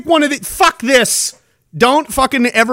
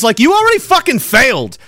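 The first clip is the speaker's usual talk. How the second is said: ordinary delivery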